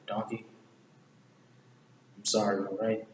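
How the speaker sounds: soft, subdued acted apology; understated apology. Retelling Shrek apologizing to Donkey, understated. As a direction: say sorry quietly, without breaking down. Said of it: "He just said".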